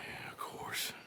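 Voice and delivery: soft unvoiced whisper, barely there. Dutch whispering his resolve to rescue Sean, hushed whisper. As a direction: breathe it as a secret.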